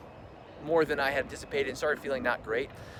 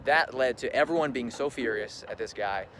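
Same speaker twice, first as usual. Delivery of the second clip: normal talking voice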